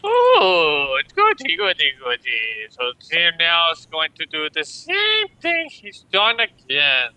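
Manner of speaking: high, loud, giddy squeal; gleeful squeal. Real, not acted. Player gleeful over turn.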